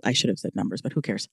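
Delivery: muttered aside. Real, not acted. Muttering an aside to herself mid-list. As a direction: drop it under your breath.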